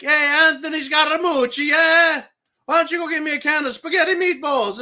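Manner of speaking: rapid mock Italian-American street accent. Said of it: "Sounds rather Italiano".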